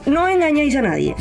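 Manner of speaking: high, teasing scold on engañáis; mocking scold. Calling out bald men, acted.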